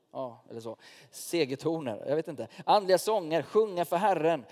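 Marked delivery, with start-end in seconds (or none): 2.6-4.4 exalted praise. Acted. Exalted call to sing praise.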